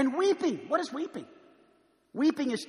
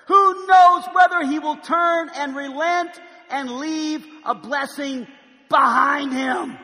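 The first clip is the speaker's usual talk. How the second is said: loud, higher, slow proclaiming of scripture; full-force preaching; 6 semitones higher, 10 dB louder than usual. Acted. Preacher proclaiming Joel to the congregation, booming. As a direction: thunder the verses to the church.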